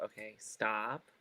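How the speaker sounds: soft, slow, quiet self-instruction; quietly telling yourself to stop; calm soft reset to stop stuttering. Retold or imitated. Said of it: "I have to just literally go".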